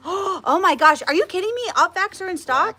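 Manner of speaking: thrilled the stock is back; excited surprise. Real, not acted.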